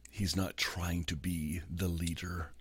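whispered reflection; hushed. Real, not acted. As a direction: drop to a whisper to draw them in. Tender reflection on weeping.

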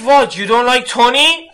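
high, loud, indignant Iranian suitor; imitated Iranian guy. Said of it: "this fresh Iranian guy".

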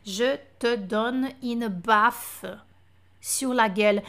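very slow, each word laid out; slow French demo. Shown as a demonstration. Teacher models the French phrase very slowly. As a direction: spell the phrase out slowly for a learner. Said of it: "aí ele".

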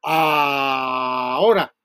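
long, stretched-out ahora; drawn out. Shown as a demonstration. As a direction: drag the word out like a cue. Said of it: "cuando diga".

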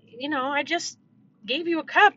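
put-on high-pitched exasperated scolding of a toddler. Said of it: "when I would be like".

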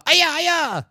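high shouted karate cry; mock karate yell. Retelling the fight scene, a loud mock karate yell. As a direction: belt out a hammy martial-arts kiai.

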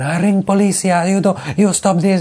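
loud, high mock Spanish accent; hotel guy's Spanish accent. Hotel worker scolding rowdy tourists, loud mock Spanish accent. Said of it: "the hotel guy's coming over going".

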